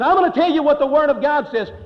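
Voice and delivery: loud, high-pitched, defiant retort; retold angry outburst. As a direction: act out an angry man laying down the law. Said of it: "he just blew up".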